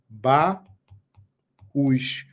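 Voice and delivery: "Ba, us" as two separate beats, long pause between; split into syllables. Shown as a demonstration. Teacher showing students the hiatus, "Ba, us" split syllable by syllable. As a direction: break "Ba, us" apart to expose the hiatus. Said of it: "estabelecendo o hiato".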